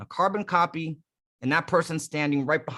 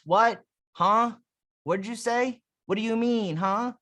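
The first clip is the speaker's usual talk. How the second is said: high-pitched puzzled questions; confused listener; 6 semitones higher than usual. Acted. Coach mimics people who cannot hear you, puzzled. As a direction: ask as if you didn't catch it. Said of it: "there's no more whats".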